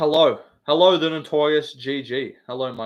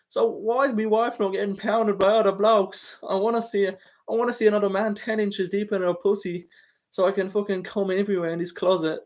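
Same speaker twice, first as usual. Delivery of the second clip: higher put-on voice; Ian Gary impression; 6 semitones higher than usual. Streamer imitating Ian Gary for chat, higher put-on voice. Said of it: "that's the Ian Gary impression".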